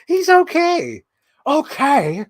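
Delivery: high, slow put-on voice; sing-song movie character line; Rocky Horror quote. Quoting Rocky Horror to the camera in a high, slow put-on voice.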